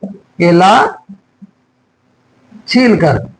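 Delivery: केला stretched, long pause, then छील कर; slow dictation. Shown as a demonstration. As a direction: dictate केला छील कर in two separated pieces. Slow repeat for students writing.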